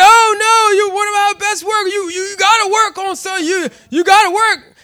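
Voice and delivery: high, loud insistent plea; pleading managers. Retold or imitated. Managers pressing their best worker to stay, high and urgent. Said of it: "They said".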